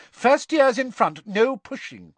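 high, loud teacher's orders; strict schoolmistress marshalling children; Professor McGonagall. A narrator's reading. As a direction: call instructions down a line of pupils. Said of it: "said Professor McGonagall".

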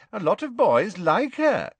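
high, loud character voice; Pansy's girlish voice. A narrator's reading. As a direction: pitch it way up as a schoolgirl.

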